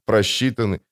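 ordinary delivery, nothing marked

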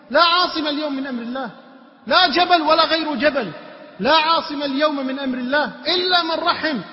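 loud, high, insistent sermon; impassioned preaching. Acted. Preacher retelling a father's answer to his son, impassioned. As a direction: hammer it home to the congregation.

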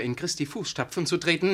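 ordinary delivery

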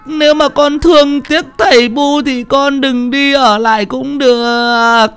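high, long-drawn funeral keening; slow, sing-song lament at a very high pitch; sobbing wail. Acted. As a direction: wail for a dead child, stretching each cry. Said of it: "mới lại khóc nấc lên".

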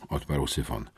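ordinary delivery, nothing marked

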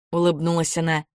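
ordinary delivery

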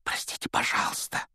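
soft unvoiced whisper. A narrator's reading. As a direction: whisper it urgently. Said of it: "прошептал Гарри".

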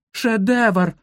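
high, emphatic outraged outburst; indignant cry. A narrator's reading. Guide quarrels with pupils, indignant cry. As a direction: hurl the word as the last argument.

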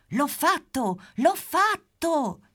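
very high, loud, drawn-out cry of triumph; triumphant shout. Acted. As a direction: burst in celebrating.

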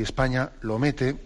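usual speaking voice

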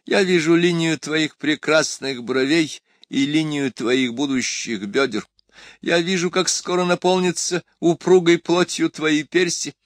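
lightly acted emperor in a high, slow declamation; grandiloquent emperor. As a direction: declaim admiringly, a hint of the emperor. (слегка обозначенный император, высокая неторопливая декламация)

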